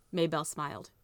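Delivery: plain reading voice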